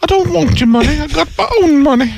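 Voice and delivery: high, put-on English lady voice; prim high lady insisting; Heather Mills impression. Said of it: "I'll do my Heather".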